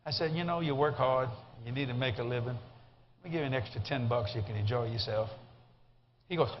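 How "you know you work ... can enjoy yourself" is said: low, soft, reassuring; calm kindness. Retold or imitated. Passenger giving the driver extra ten bucks, calm and kind. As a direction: gently let him off, generous and unhurried.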